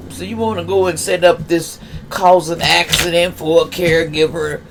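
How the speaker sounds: normal talking voice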